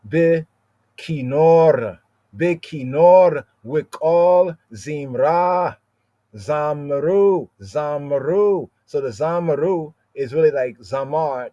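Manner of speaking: ordinary talk, nothing marked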